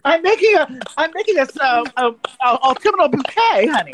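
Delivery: high, sashaying and theatrical; campy sassy voice. Pretend. Camp comeback to the bro about flowers. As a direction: lay on the camp, honey.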